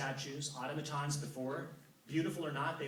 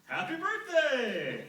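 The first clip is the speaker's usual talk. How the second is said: Apollo voice; 13 semitones higher, 7 dB louder, much slower than usual. Acted. Apollo greets narrator in story.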